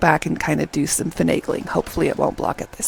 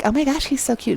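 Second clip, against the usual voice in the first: high-pitched imitation of a smitten friend; light mock swooning, pitched up; gushing bestie; 6 semitones higher than usual. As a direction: gush like her bestie. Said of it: "And the bestie's like".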